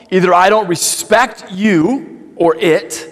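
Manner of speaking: ordinary delivery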